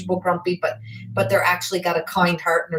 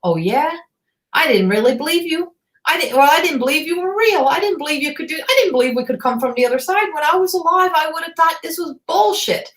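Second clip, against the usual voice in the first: lightly voiced doubting man, a bit higher; a hint of a cocky skeptic scoffing; skeptical spirit man; 7 semitones higher than usual. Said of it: "got that attitude, like".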